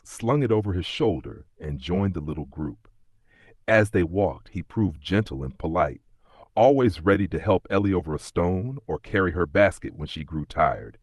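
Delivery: plain reading voice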